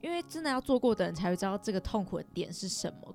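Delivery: ordinary delivery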